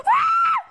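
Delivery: piercing high scream; terrified scream. Real, not acted. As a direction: scream as it lunges. Monster approaches.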